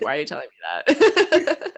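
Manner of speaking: loud laughing protest; laughing disbelief. Retold or imitated. Laterrian reacting to his partner's confession, laughing loudly. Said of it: "And she's like".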